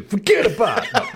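high, hammed-up Boston accent with a laugh; jokey Boston accent, pitched high; mock Boston accent. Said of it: "The most confident accent is Boston".

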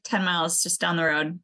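usual speaking voice

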